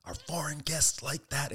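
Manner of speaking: a hint of an angry respectable old man; mock furious boss. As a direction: hiss the rebuke slowly. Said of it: "the guy gets furious".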